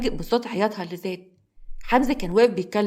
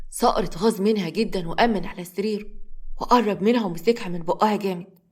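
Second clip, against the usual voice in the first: usual speaking voice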